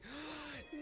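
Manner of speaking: sudden high gasp of dismay; shocked high gasp. Retold or imitated. Player hearing Bob-omb say make her laugh, high gasp.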